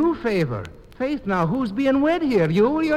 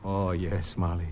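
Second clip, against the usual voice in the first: tender reply; 7 dB quieter than usual. Acted. Accepting proposal tenderly.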